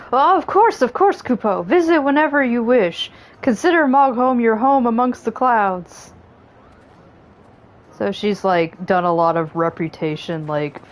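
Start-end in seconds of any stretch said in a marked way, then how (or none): 0.1-6.1 high, chirpy Moogle host; squeaky, bouncy little creature. Acted. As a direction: welcome them like an eager Moogle. Reads Moogle dialogue.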